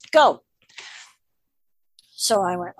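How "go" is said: sudden loud higher Go; mom's loud starting command; sharp command. Retold or imitated. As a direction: snap out the order to start. Mom starts the horse ride.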